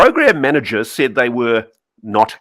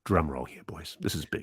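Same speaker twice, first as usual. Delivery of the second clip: much softer, teasing the big reveal; conspiratorial hush for the listeners; hushed suspense; 7 semitones lower, 13 dB quieter than usual. Real, not acted. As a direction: drop your voice before the secret.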